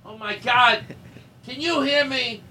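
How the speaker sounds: high, fed-up call; exasperated high call. Pretend. Calling across rooms sketch.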